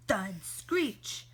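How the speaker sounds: usual speaking voice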